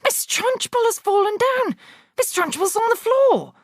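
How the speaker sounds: put-on very high shrill child's shout; excited scream. A narrator's reading. Pupil screaming that the headmistress fell. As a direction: shriek the news to the class.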